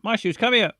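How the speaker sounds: high, loud mock calling across a park; calling a dog. Owner calling the dog across the park, high loud yelling. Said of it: "Running across the park screaming".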